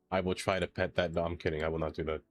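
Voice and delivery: ordinary talk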